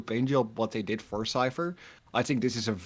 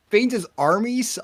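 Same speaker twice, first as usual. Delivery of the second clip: announcing; 10 semitones higher, 7 dB louder, slower than usual. Real, not acted. Announcing count.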